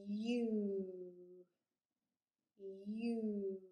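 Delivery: U drawn out from a y glide into oo; long vowel U. Shown as a demonstration. Teacher shows the changing mouth, U stretched out. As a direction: hold the letter name U long. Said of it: "I'm gonna say a long vowel sound".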